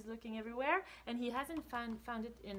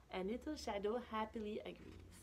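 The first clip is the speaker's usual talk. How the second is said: usual speaking voice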